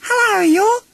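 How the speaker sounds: very high, bright little joey; eager Roo. Acted. Little Roo greets Eeyore, high and eager. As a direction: squeak it up eagerly like a tiny kangaroo. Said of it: "Roo said eagerly".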